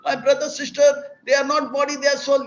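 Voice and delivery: put-on a young son working it out aloud, pitched high; eager boy voice reasoning step by step; child's voice. Son puzzling out death to his father, in high child voice.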